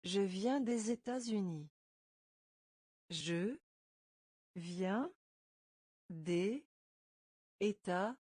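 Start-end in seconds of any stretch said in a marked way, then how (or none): none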